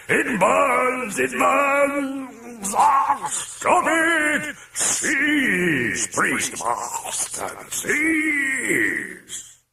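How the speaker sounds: high, screeching demon wail; bellowing demon in pain. A narrator's reading. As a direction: howl in agony like a burned demon. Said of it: "bellowing in terror and in pain".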